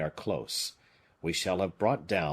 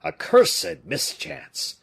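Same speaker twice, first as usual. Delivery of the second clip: slow, loud curse of dismay; dismayed cry; 7 semitones higher, 8 dB louder, much slower than usual. A narrator's reading. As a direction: spit out the bad luck. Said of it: "cried the bishop".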